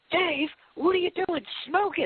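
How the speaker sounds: very high-pitched mock yell; high yelp at no extra volume; retold yell. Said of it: "he freaking yells".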